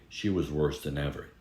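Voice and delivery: usual speaking voice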